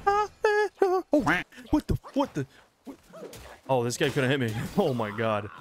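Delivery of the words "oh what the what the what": very high, loud startled stammer; startled shriek. Real, not acted. Player caught out by a surprise, startled shriek. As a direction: stumble over it in shrill shock.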